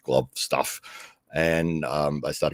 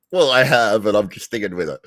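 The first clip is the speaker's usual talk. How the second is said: defiant; 6 semitones higher, 6 dB louder than usual. Retold or imitated. Defiant reply to everyone.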